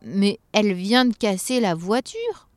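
slow, drawn-out outrage rising at the end; acted elf indignation; shocked elf exclamation. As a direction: exclaim in disbelief like an aghast elf. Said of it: "s'écria le lutin".

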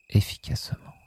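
efficacement whispered, no voice; soft spa whisper. Shown as a demonstration. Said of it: "echo of efficacement".

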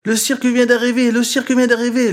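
high, loud calling out to his brothers; little frog yelling to his brothers; excited shout. A narrator's reading. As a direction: shout the news, bursting with excitement. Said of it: "leur crie-t-il".

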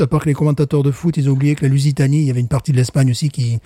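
ordinary delivery

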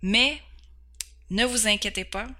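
ordinary delivery

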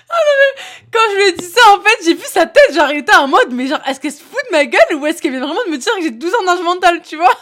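loud, high, breaking into laughter; cracking up mid-sentence; real fit of giggles; laughing hard. Real, not acted. Helpless laughter retelling his face.

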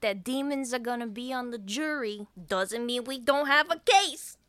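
pushy rising argument, ending loud; arguing lawyer. Retold or imitated. Voicing the lawyer arguing back.

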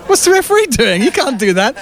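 high, outraged protest, mock players; indignant players. Rival players protest the scoring referee, high mock outrage. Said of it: "the rest of the world team were like".

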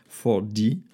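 slow, careful English words in a French English accent; self-mocking English accent. Shown as a demonstration. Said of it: "avec mon super accent".

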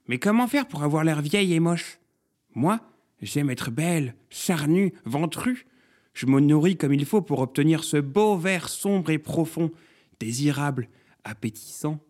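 fussy objection turning to self-admiration; vain neighbour courgette. A narrator's reading. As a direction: savour your own good looks.

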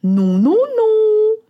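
high, loud, slow little bread voice; tiny runaway bun squealing no; little simit crying no. A narrator's reading. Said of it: "qui roule très vite en disant".

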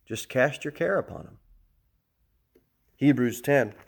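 ordinary talk, nothing marked

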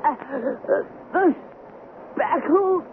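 high, choked, slow dying cry; strangled voice. Retold or imitated. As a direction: gasp it out as if choking. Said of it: "in a strangled voice cried out".

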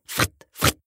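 voiceless quick whooshing noise; two short bursts of breathy sound effect; imitated whoosh. A narrator's reading. Rabbit darts from the opened chest, whooshed sound effect.

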